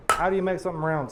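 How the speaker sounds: normal talking voice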